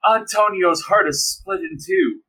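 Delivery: high, very slow grieving line; melodramatic. Acted. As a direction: mourn it like a soap star. Melodramatic soap confrontation.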